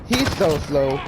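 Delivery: ordinary talk, nothing marked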